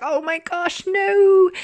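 high, loud "no" of dismay; a dramatic wail at a tragic twist; shocked cry. Retold or imitated. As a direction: gasp it out like a gut punch. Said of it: "I was like".

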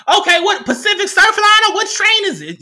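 high, loud imitation of a yelling station worker; yelling Amtrak worker. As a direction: holler directions across the platform.

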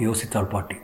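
usual speaking voice

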